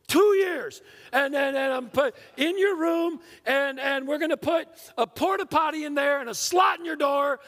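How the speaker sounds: high, loud, spluttering outburst; dad losing it, doling out wild punishments; angry dad rant. Acted. Dad grounding his fourth grade son, furious hammed-up shout.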